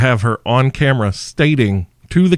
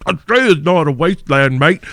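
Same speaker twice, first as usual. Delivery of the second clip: mock Australian; 5 semitones higher than usual. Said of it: "start messaging me like".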